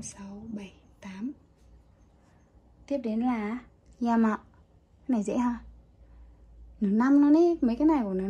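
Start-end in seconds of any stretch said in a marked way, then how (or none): 0.0-1.3 whispered count. Real, not acted. Counting strokes under her breath. 6.8-8.0 excited outburst. Real, not acted. Joking about the character.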